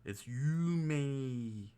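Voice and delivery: It's you may, very slow, with the long E held in may; careful slow yumei demo; slow stretched vowel. Shown as a demonstration. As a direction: drag out the E of may. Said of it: "you gotta stretch that E part".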